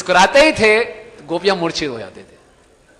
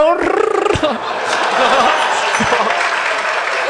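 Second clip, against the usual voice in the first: ecstatic laugh; 8 semitones higher, 7 dB louder than usual. Retold or imitated. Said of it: "वो बोला".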